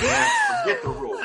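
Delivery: high laughing burst through the words; laughing retort. Retold or imitated. Telling the ref he'll ignore the rule, laughing. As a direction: laugh it out defiantly. Laughing while retelling his reply.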